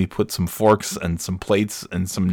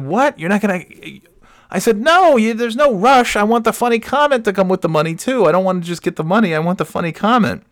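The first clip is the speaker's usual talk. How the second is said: high, fast squeaky pleading; high mock protest; 13 semitones higher, 3 dB louder than usual. Husband pleading with his wife for the joke, high mock whine. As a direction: whine it up high like a hurt kid.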